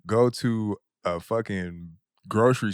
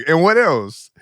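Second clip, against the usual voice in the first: mock stern demand; 6 semitones higher, 8 dB louder than usual. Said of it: "And she was like".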